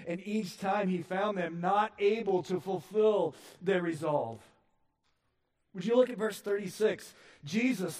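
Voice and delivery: high, loud preaching that builds; full-force preaching. Acted. Pastor urging the congregation, loud and high. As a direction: drive it home from the pulpit.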